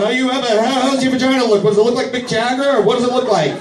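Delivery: high-pitched comic panic; frantic questioning. Acted. Panicked dad accosting women shoppers, high frantic questioning. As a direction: pester strangers in a high, frantic voice.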